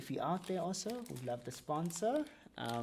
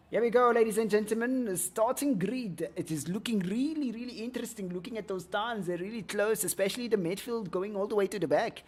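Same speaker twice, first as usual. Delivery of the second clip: higher, upbeat caster voice; race commentary; 6 semitones higher, 5 dB louder than usual. Acted. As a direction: call the starting grid with energy. Pre-race grid commentary.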